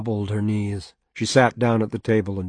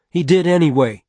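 higher, louder biting comeback; sharp angry retort; 7 semitones higher, 7 dB louder than usual. Acted. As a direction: snap it back at him.

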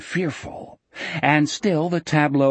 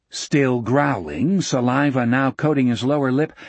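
ordinary delivery

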